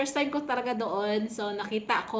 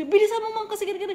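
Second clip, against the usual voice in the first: higher, louder rushing order; impatient hurry; 11 semitones higher, 5 dB louder, faster than usual. Retold or imitated.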